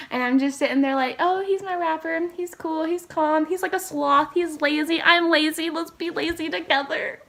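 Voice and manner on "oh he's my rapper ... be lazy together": higher, swooning fan voice; dreamy fangirl. Retold or imitated. Vlogger voicing her own crush on the rapper, higher and swooning. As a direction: gush like a smitten fan. Fangirl daydream about idol.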